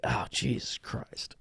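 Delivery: breathy, slow whisper of dread; weary whisper. Pretend. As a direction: sigh it under your breath. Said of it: "she's like".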